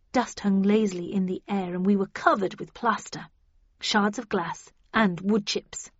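ordinary delivery

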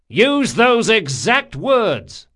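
loud, slow cry. A narrator's reading. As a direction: call it down loudly. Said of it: "Locke cried".

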